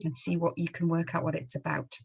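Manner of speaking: ordinary delivery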